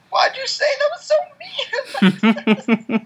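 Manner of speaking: very high, slow, laughing whine of fake hurt; mock hurt whine. Teased as a crybaby, answering friends in a mock-hurt, very high, slow whine. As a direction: wail it like a wounded child, half laughing.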